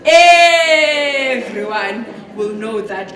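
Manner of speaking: "everyone" very loud and hugely drawn out; stress on everyone. Real, not acted. Mothers' moods affect the house, "everyone" loudly stretched. Emphasising how a mother's mood spreads.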